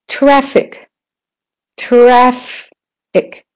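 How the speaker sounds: "traffic" with high, long TRAF; stress on TRAF. Shown as a demonstration. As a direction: stretch the first syllable of "traffic", quick IC. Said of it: "The I C is quick".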